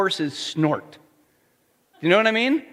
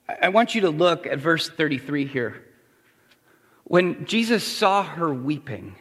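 ordinary talk, nothing marked

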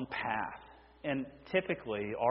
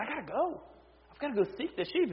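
much higher, urgent and quick; pitched up, eager to set off now; shepherd's resolve; 11 semitones higher, faster than usual. Acted. Shepherd deciding to leave the flock and find the lost sheep, high urgent voice.

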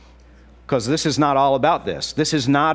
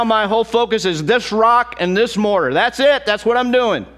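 much higher, loud defiant declaration; defiant shout; 10 semitones higher, 5 dB louder than usual. Retold or imitated. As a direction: shout down the threats, unbothered. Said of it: "He's a guy that goes".

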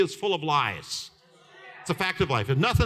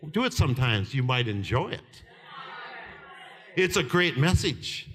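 usual speaking voice

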